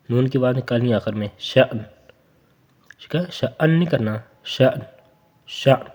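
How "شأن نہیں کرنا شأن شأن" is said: ordinary talk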